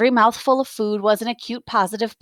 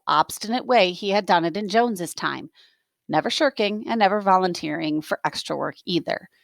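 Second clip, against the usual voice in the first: ordinary delivery, nothing marked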